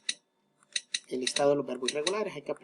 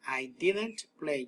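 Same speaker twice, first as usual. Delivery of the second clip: slow teaching model; much slower than usual. Shown as a demonstration. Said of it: "le antepongo la palabra didn't".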